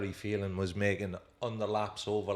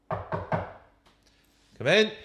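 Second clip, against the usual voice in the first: higher, louder imitated call; 8 semitones higher, 6 dB louder than usual. Manager calling a player into his room, calling out. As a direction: call it out to someone at the door.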